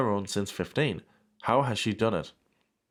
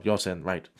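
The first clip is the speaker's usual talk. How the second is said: ordinary delivery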